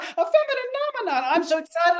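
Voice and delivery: very high, quick puppet voice; the puppet pipes the catchphrase high and fast. Acted. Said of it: "My puppet, he stayed up past his bedtime 'cause he wanted to say what?".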